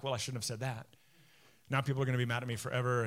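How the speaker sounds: low, muttered worry that speeds up; anxious self-reproach. Retold or imitated. Preacher mimicking his worries after a podcast, low fretting. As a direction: imitate fretting to yourself, rushing the end.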